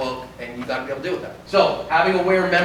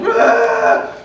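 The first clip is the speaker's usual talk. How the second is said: much higher, loud panicked wail; lost child crying out; 9 dB louder than usual. Retold or imitated. Lost child at the mall wails, high and loud. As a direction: cry out like a scared little kid. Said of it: "I see this little".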